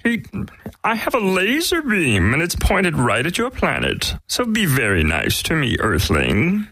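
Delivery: very high, slow cartoon alien; prim high-pitched alien villain; Marvin the Martian. Shown as a demonstration. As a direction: threaten politely in a high little Martian voice. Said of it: "but I'd like to hear it".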